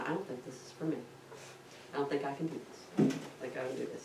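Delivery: soft retold admission of defeat; discouraged. Shaken student to the instructor, quietly giving up. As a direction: back out quietly, voice sinking.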